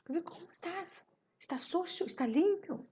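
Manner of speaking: put-on high voice, quizzing a little boy; entonação exclamativa. Shown as a demonstration. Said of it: "eu já dou entonação no co". (perguntas em voz aguda e exagerada)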